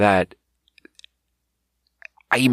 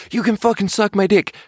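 high shouted insult, half in jest; a hammed-up yell of an insult; mock yell; 12 semitones higher than usual. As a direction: yell it at a coworker, mock-aggressive. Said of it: "if you yell".

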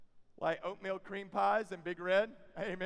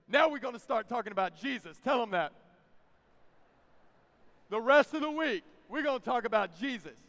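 loud, higher, drawn-out proclamation of faith; full-force preaching; 6 semitones higher, 6 dB louder, slower than usual. Real, not acted. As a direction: build it slow and loud, stretching each phrase.

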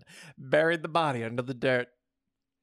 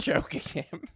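plain reading voice

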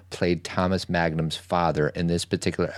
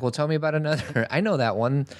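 higher and fast mock dad, a laugh inside; dad's quick follow-up; 8 semitones higher, faster than usual. Father asking for the next monster, quick and amused. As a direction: hurry on, amused.

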